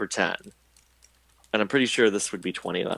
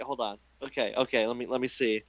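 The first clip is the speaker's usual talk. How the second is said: ordinary talk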